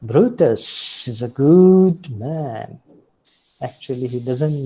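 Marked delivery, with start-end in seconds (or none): 0.0-2.8 slow and louder, rising then falling, pointed irony; ironic praise. Retold or imitated. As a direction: say it slowly as if you mean the opposite. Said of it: "sarcasm or irony".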